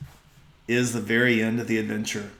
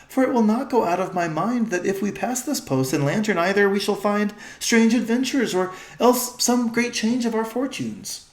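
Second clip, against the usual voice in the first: higher, stately queen foreseeing adventure; regal old-fashioned speech; 8 semitones higher than usual. Acted. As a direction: speak of fortune like a queen of old. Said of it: "their voice has changed".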